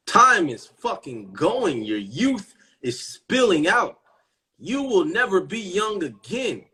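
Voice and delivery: pressing the point harder each line; rising urgency. Real, not acted. To the audience about wasted youth, building urgency.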